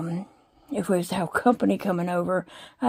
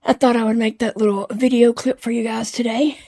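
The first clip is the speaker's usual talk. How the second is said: usual speaking voice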